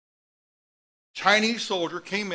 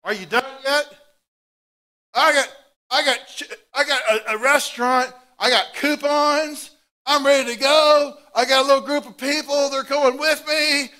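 loud, higher, pushy soldier wanting to go home; impatient soldier; 7 semitones higher, 8 dB louder than usual. Acted. A soldier grumbling at the cross, loud and impatient. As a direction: hurry the dying man along, higher and louder. Roman soldier voiced at crucifixion.

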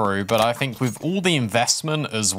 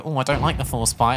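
ordinary talk, nothing marked

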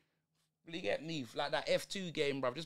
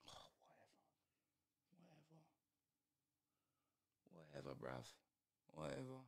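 fed-up muttered whisper; 6 semitones lower, 23 dB quieter than usual. Real, not acted. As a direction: breathe "whatever" under your breath, near silent. Muttering to himself in disgust.

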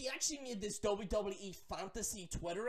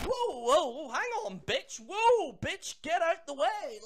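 higher, louder startled shouting; mock alarmed yell; 7 semitones higher, 8 dB louder, slower than usual. Vlogger acts his startled reply to fangirl, loud. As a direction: back off, loud and high. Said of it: "and you're like".

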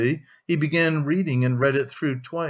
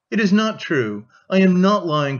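a higher, louder cry of denial; losing composure; 5 semitones higher, 5 dB louder than usual. A narrator's reading. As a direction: snap back at her brother, stung. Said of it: "cried Dounia, losing her composure".

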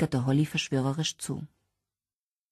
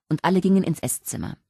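plain reading voice

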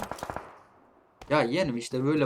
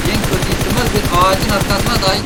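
raised voice, lecturing the enemy; loud taunting lecture; loud scolding; 4 semitones higher, 9 dB louder than usual. Real, not acted. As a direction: tell him off loudly over voice chat.

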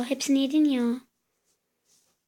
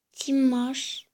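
ordinary delivery

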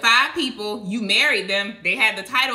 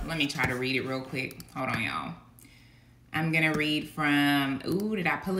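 low, soft aside to listeners; casual off-sermon aside, much softer; quiet aside; 8 semitones lower, 7 dB quieter than usual. Real, not acted. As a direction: drop to a hushed, low mumble while searching. Private aside while finding the passage.

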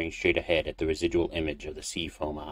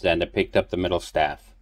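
ordinary delivery, nothing marked

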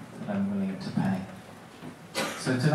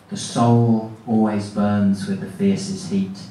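loud, declamatory poetry reading; poem recitation; 6 dB louder than usual. Acted. Poet reading from the library stacks to a live audience, loud and declamatory. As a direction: declaim it forcefully, stretching the big closing lines.

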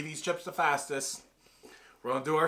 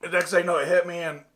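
hiccups; 5 semitones higher, 7 dB louder than usual. Real, not acted. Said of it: "I got the hiccups".

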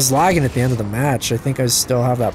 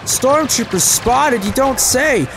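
sarcastic mock callout; 10 semitones higher, much slower than usual. Real, not acted. Mocks game callout sarcastically.

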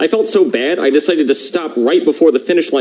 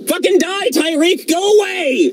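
angry high yell; slower than usual. Acted. Alone in the car, yelling at Tyreek to go away.

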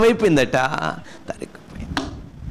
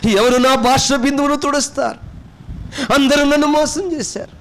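despairing lament; 5 semitones higher than usual. Acted. Preacher voicing an abandoned sinner, building despairing lament. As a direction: voice the forsaken soul, climbing in anguish. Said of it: "అనుకున్నప్పుడు".

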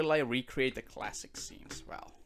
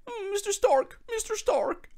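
put-on very high whiny boy voice; squeaky high fanboy plea; Spider-Man voice; 6 dB louder than usual. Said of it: "And he's always like".

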